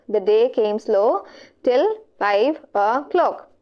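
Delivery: slow, spaced-out reading of the line; slow reread. Real, not acted. Teacher rereading an answer option to students, slowly. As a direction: read it slowly, pausing between phrases.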